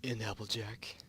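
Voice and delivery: breathy whispered reply; whispering. Acted. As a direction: whisper it timidly. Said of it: "That was supposed to be Fluttershy".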